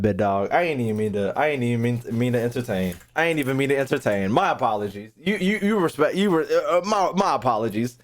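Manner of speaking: ordinary delivery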